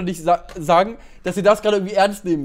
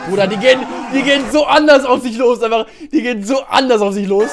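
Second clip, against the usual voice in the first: excited; 5 semitones higher, 7 dB louder than usual. Real, not acted. Reacting live to the fight starting.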